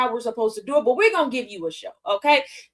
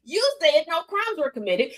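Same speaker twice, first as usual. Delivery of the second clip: ordinary talk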